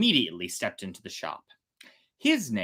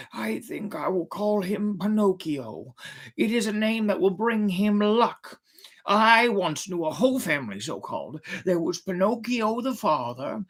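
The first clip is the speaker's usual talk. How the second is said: put-on old man voice; 8 semitones higher than usual. Geppetto names his puppet.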